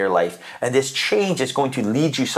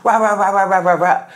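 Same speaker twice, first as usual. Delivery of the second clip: higher, louder little dog yapping; mock chihuahua yap, pitched up; yappy chihuahua; 6 semitones higher, 4 dB louder than usual. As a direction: bark it like a tiny chihuahua. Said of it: "A little chihuahua".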